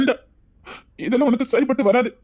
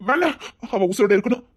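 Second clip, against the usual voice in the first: building from steady to a high, breaking cry; desperate plea; 4 semitones higher than usual. Acted. Frightened for the girl, building to a high desperate cry. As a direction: repeat it more frantic each time.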